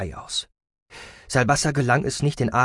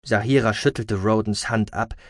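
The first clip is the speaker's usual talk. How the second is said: usual speaking voice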